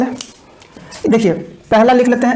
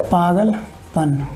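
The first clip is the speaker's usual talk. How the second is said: पागलपन drawn out slowly and softer; slow dictation; 4 semitones lower, 5 dB quieter, much slower than usual. Shown as a demonstration. Teacher dictating पागलपन slowly and softly. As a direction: stretch it out for writing. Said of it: "तो यहाँ पे लिख लीजिएगा".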